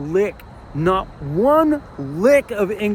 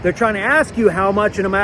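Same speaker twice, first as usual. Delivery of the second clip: ordinary delivery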